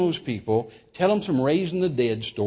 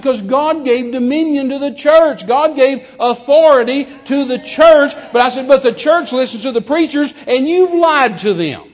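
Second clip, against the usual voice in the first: thundered indictment; 9 semitones higher, 7 dB louder than usual. Retold or imitated. Guest charging preachers with lying to the church, thundered indictment. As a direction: drive the charge home loudly.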